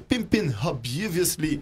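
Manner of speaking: slow, hammed-up English accent on the track name; mock English accent. Radio host announcing the track name in a mock English accent. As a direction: show off a playful English accent. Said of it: "avec mon magnifique accent anglais".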